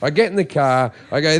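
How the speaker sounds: ordinary talk, nothing marked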